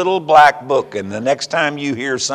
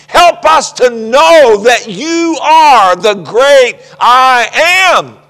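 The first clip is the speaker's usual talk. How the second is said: high, loud, building shout to God; fervent preaching prayer; 10 semitones higher, 8 dB louder than usual. Acted. Preacher leading congregation in prayer, shouting. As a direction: preach the prayer full force. Closing prayer at full force.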